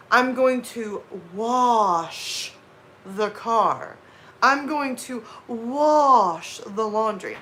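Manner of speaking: wash said slowly as "wahsh", no r; slow, deliberate wash without an r; careful wash. Retold or imitated. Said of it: "I spent days where I was like".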